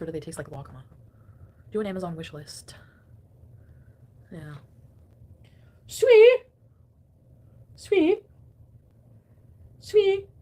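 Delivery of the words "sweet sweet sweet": high, loud sing-song call. Acted. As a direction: call out the nickname loudly. Calling the new nickname.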